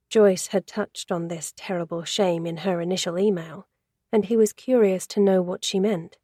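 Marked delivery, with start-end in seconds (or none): none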